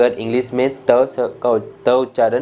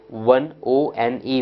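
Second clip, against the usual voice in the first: slow spelling out; much slower than usual. Shown as a demonstration. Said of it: "ओ का उच्चारण व केवल एक ही शब्दों में".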